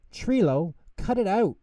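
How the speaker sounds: high, loud protest; annoyed shout. Acted. Pip and Pop tell the pretend monster off, high and loud. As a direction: make him stop, loudly. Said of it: "Pip and Pop said".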